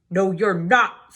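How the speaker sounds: loud, slow troll voice. Acted. Troll blocking the goat's way, loud and slow. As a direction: bark a flat no at the goat.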